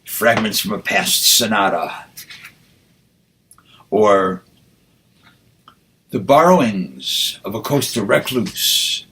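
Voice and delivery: measured, deliberate reading with rising lines; poetry recitation. Acted. Poet reading his poem aloud to the audience, measured recitation. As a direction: recite it like a poet at a reading, savoring each phrase.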